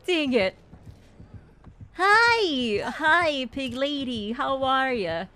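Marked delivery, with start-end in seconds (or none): 1.9-5.2 higher, drawn-out "hi" that settles down; mock-friendly greeting. Player greets the pig lady in game, mock-friendly. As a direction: greet the monster like an old pal.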